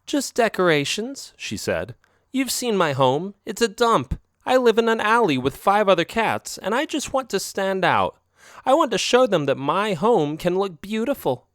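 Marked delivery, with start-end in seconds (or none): 0.1-1.3 put-on higher, coaxing young female cat voice; sly girlfriend cat. A narrator's reading. Narrator voices Isabel. 2.3-11.5 put-on higher, coaxing young female cat voice; sly girlfriend cat. A narrator's reading. Narrator voices Isabel.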